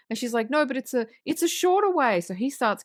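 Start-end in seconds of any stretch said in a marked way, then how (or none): 0.5-2.2 high-pitched mock insistence; high, exasperated comeback in an acted quote; retold protest. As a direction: imitate someone arguing back, voice pitched right up.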